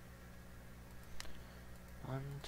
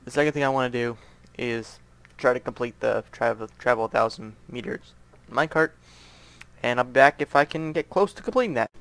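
ordinary talk

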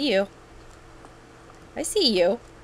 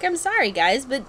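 high-pitched excuse to viewers; mock apology; 9 semitones higher than usual. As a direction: plead your excuse in a high voice. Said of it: "I'm, I'm like".

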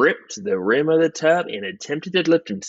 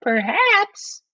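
very high falsetto; high-pitched, tentative wife; suggesting wife. Acted. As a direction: suggest it in a piping wife's voice.

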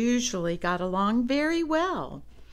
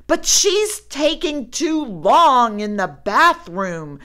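loud, higher complaining; 6 semitones higher, 9 dB louder than usual. A narrator's reading. Brother complaining to Papa about sister, loud whine. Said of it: "complained Brother".

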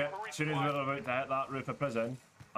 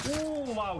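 sharp high surprised oh wow; surprised gasp. Real, not acted. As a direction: caught off guard, genuinely impressed. Surprised at enemy's good shots.

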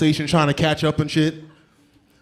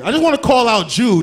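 ordinary delivery

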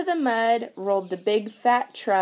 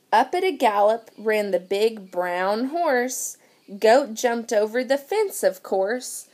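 ordinary delivery

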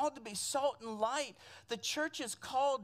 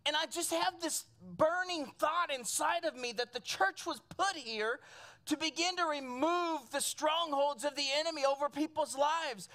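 usual speaking voice

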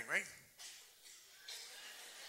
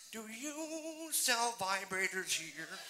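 slow timid ask; 6 semitones higher, much slower than usual. Acted. Old lady asks shop clerk.

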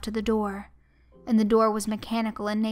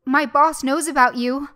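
higher, louder anxious warning; urgent warning; 5 semitones higher, 7 dB louder than usual. A narrator's reading. Victim bluffing to be let go, urgent warning. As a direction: warn urgently, voice raised. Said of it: "I warned".

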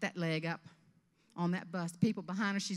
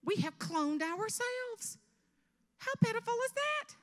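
hammed-up wonder, pitched up; mock amazement; 14 semitones higher, 4 dB louder than usual. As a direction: climb higher in exaggerated disbelief. Mock amazement at sounding alike.

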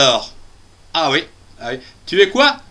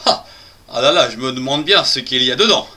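ordinary talk, nothing marked

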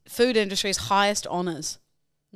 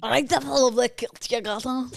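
higher, imitated Scottish accent; 5 semitones higher than usual. Co-host mimics kilt compliment, Scottish accent. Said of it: "but in the accent".

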